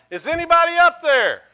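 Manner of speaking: high, loud cry for help from a cliff; desperate yell. Acted. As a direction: shout it up into the empty sky. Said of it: "he begins to yell".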